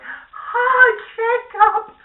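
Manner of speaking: very high, loud fangirl squeal; squealing fans. Retold or imitated. Women in the theater squealing at Jacob, very high. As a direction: shriek it at the screen like a fangirl. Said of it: "all the women were like".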